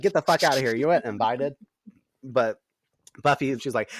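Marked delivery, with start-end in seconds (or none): 0.0-1.6 louder, higher snarl at intruders; a hint of an angry vampire; mock angry Spike. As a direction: shoo them off in mock rage.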